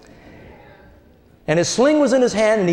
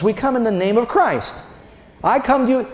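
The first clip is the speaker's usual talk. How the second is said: ordinary delivery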